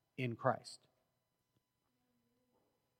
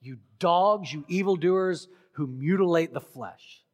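loud, emphatic name-calling; strong denunciation; 6 dB louder than usual. Retold or imitated. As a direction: hurl the names out forcefully as a quoted rebuke. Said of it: "That's kinda strong".

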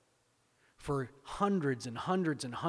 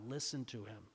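much softer, intimate command; hushed; 4 semitones lower, 8 dB quieter than usual. Real, not acted. Preacher quietly urging the congregation. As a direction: lean in and say it softly.

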